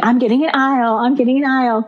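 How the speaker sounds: a secretly thrilled inner chant; gleeful inner voice. Retold or imitated. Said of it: "jumping up and down thinking".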